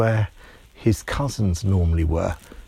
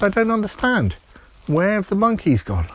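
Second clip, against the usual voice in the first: put-on much higher, bewildered child's voice; a small boy lost for answers, pitched up high; puzzled little boy; 11 semitones higher than usual. Timmy puzzled at the zoo.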